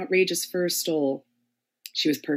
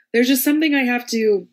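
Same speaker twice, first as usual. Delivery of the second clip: higher, louder, bursting out; agitated; 7 semitones higher, 8 dB louder than usual. Acted. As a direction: blurt it out, then catch yourself. Hamlet blurts a troubled confession.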